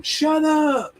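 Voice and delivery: high, sudden pretend shout; jokey shout at the birds outside; mock yell at birds. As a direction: snap it up high at the noisy birds. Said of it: "I can't go out there and yell at 'em".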